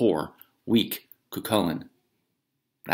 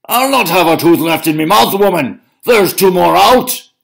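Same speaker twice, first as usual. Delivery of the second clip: hollering; 10 semitones higher, 12 dB louder than usual. Acted. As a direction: holler about lost teeth. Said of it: "Cucullin hollered even louder".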